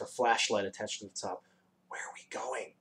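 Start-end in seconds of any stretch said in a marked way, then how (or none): 1.9-2.7 very soft, hushed question; whispered. A narrator's reading. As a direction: ask it under your breath. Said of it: "whispered Milo".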